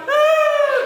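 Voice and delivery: high, loud put-on wail; hammed-up "ah" of distress; fake crying wail. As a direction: bawl like a banished little brother. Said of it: "Your brother's going out".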